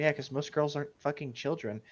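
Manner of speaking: ordinary talk, nothing marked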